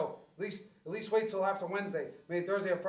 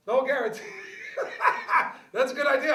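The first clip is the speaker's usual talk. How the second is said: normal talking voice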